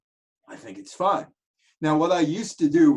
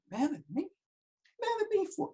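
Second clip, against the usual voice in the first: much higher, startled disbelief; surprised; 7 dB quieter than usual. Retold or imitated. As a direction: squeak it out, caught off guard. Shocked reply to Yogi.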